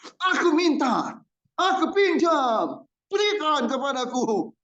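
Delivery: high, drawn-out mock demanding voice; mock demanding borrower. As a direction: act a rude borrower making demands. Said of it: "ada orang datang, minta tolong".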